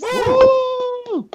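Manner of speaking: loud high whoop; cheering woo. Real, not acted. Cheering the intro.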